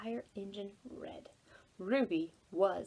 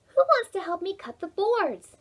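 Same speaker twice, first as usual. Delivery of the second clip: much higher, louder ask; cheerful girl asking; 9 semitones higher, 8 dB louder than usual. A narrator's reading. Ruby to the boys, a much higher, louder cheerful ask. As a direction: call out brightly for helpers.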